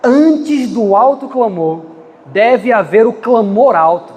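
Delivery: slow, deliberate divine words; slow solemn voice. Retold or imitated. The preacher recalls God's voice in his ear, slow and solemn. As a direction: weigh every word slowly. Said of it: "veio uma voz assim no meu ouvido".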